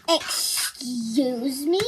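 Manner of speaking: slow, stretched-out indignant question; exaggerated, drawn-out disbelief; mock offence. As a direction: drag it out as an affronted stepmom. Stepmom's offended reply, acted.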